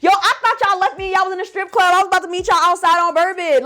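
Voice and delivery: high-pitched animated protest; worked-up disbelief. Retold or imitated. Retelling to roommate she thought they left her, worked-up disbelief.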